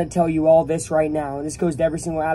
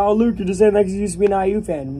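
quick, raised-pitch mimicry of critics; mock accusation; 4 semitones higher, 4 dB louder, faster than usual. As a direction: parrot the doubters, faster and higher.

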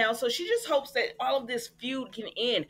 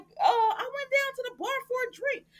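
much higher, whiny fake-innocent excuse; mock sheepish husband. Husband caught lying to his wife, mock whiny excuse. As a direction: play the caught husband squeaking out an alibi. Host voices Tucker's excuse.

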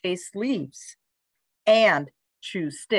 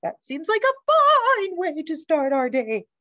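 high sing-song agreement; chirping bird; 8 semitones higher than usual. A narrator's reading. Bird agrees to keep reading to dog, high chirp. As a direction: chirp it high, lingering on fine. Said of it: "chirped the bird".

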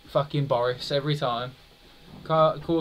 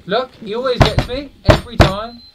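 high, loud frustrated cry; exasperated outburst; 10 semitones higher, 12 dB louder than usual. Real, not acted. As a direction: yell it at the screen, fed up. Exasperated outburst at game enemy.

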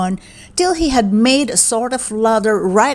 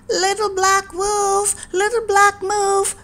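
calling out; 9 semitones higher than usual. A narrator's reading. Boy searches for the missing babies, calling out high. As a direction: call the missing little ones by name. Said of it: "he called".

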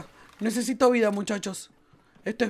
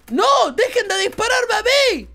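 high desperate shout; 8 semitones higher, 4 dB louder than usual. Real, not acted. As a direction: yell in protest. Being shot at by enemies.

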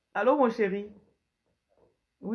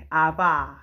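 ordinary talk, nothing marked

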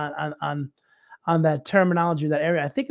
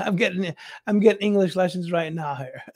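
normal talking voice

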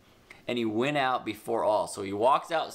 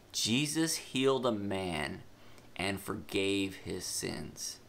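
slow dictation; 3 semitones lower, 3 dB quieter, slower than usual. Shown as a demonstration. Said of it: "I need you to fill this in".

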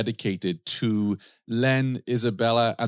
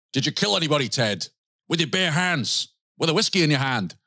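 bad Scottish accent pitched higher, pushing hard; Webster's terrible Scottish accent; 6 semitones higher than usual. Host mimics interviewer Webster grilling Ted, in a bad Scottish accent. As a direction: play the aggressive Scot in a clumsy Scottish accent, higher. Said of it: "terrible Scottish accent there".

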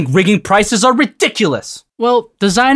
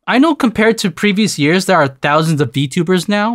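ordinary talk, nothing marked

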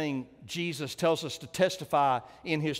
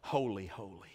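much lower and softer, hushed in awe; awed hushed cry; 8 semitones lower, 9 dB quieter than usual. Real, not acted. Preacher overcome by the last words, hushed awe. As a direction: breathe it out in reverent wonder. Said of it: "I just want to cry".

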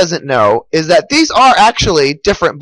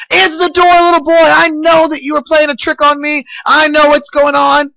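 high, raised angry storybook voice; infuriated mayor; 8 semitones higher than usual. A narrator's reading. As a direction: act out an enraged man demanding entry. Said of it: "the mayor is infuriated".

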